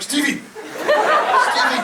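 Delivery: mock frantic calling out; shouting his name. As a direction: yell to wake a passed-out mate. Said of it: "They're like".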